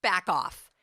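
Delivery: loud, firm back off; attitude. Shown as a demonstration. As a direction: shove it away with your voice. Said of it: "You gotta get an attitude like this".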